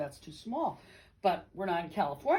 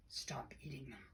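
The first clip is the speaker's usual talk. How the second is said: soft breathless whisper, lower; 7 semitones lower, 15 dB quieter than usual. Retold or imitated. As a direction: breathe the words like a guilty secret. Savoring the delicious fish.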